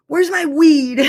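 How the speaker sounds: mock-panicked cry for the weed; alarmed demand. Retold panic about missing weed.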